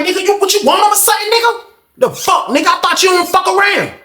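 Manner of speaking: very high-pitched taunting; retold squeaky-high provocation; goading challenge. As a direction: needle him for not fighting back.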